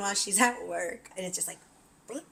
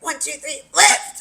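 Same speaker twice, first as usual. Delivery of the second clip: counting then shouting lift; 8 semitones higher, 10 dB louder than usual. Retold or imitated. Lifting the mattress together.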